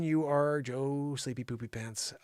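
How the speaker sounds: ordinary talk, nothing marked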